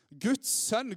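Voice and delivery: normal talking voice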